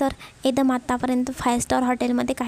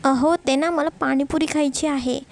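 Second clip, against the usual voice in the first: usual speaking voice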